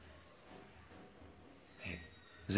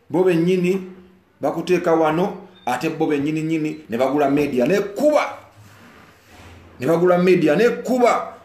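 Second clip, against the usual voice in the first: much higher, louder outraged tirade; heated rant; 7 semitones higher, 8 dB louder than usual. Real, not acted. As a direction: vent your anger at them. Heated rant about people buying media.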